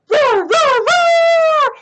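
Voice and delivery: high, loud, stretched roars; unrestrained storybook roaring; full-volume dinosaur roar. Acted. Too-loud roar, then told off.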